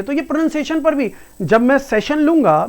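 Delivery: ordinary talk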